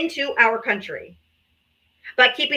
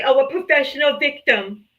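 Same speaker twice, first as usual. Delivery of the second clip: mock whine; 2 semitones higher than usual. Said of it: "whine and cry and say".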